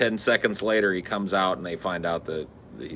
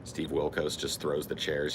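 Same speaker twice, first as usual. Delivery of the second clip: ordinary talk, nothing marked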